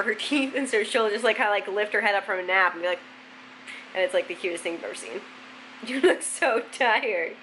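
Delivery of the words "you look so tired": slow, cooing pet voice; drawn-out coo to a sleepy dog. Real, not acted. Talking to the dog.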